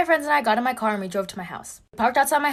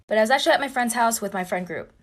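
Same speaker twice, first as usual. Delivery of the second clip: ordinary delivery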